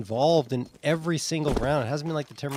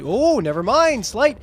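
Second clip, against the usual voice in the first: loud, high sudden reaction; surprised; 6 semitones higher, 5 dB louder than usual. Real, not acted. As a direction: catch yourself, then call it loud. Caster interrupted by sudden kill.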